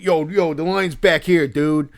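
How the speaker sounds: loud, high, in-your-face dude voice; intense Philly dude. Retold or imitated. Stranger telling a fan where the line is, intense Philly dude. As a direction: imitate an intense guy from Philadelphia. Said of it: "the impression that I was doing of him".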